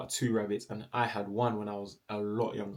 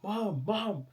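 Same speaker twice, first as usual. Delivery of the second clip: high urgent call through the letterbox; screamed call; 10 semitones higher than usual. Retold or imitated. Calling mum through the letterbox, a high urgent yell. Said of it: "I've screamed like".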